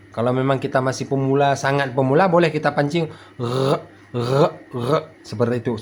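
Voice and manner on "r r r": "r" as a bare throaty gargle; short separate gargles on each "r"; gargling vibration. Shown as a demonstration. Teacher coaxing beginners, "r" gargled like morning mouth-rinsing. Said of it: "Seperti getaran saat kita berkumur-kumur".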